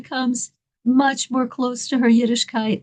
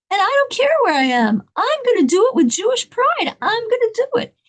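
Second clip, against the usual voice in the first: high, emphatic declaration; acting a woman summoning defiant pride; proud resolve; 6 semitones higher than usual. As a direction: declare it to yourself with rising conviction. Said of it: "with tremendous pride".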